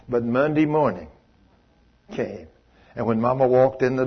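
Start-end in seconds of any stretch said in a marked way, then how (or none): none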